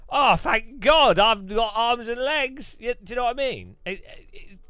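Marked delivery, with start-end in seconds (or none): 0.1-2.8 loud, slow, high cry of relief; hammed-up shout of relief; mock relieved shout. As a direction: bellow it out like a smug reader thanking God. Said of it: "It's for them to go".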